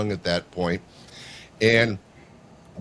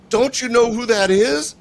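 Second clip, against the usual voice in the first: high, louder disbelieving question; incredulous coworkers; 12 semitones higher, 5 dB louder than usual. Retold or imitated. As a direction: coworkers amazed she didn't know. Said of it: "They go".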